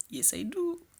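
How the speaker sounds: high, sped-up squeaky answer; reply like a fast-forwarded tape; tiny chipmunk yes; sped-up squeak. Pretend. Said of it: "je parle comme ça, vitesse fois quatre".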